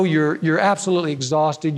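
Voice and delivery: usual speaking voice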